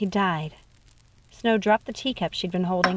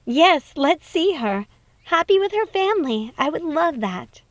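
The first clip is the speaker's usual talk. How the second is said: Snow delighted; 6 semitones higher, 4 dB louder than usual. Acted. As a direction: savour the joy of seeing family. Snow happy about wedding.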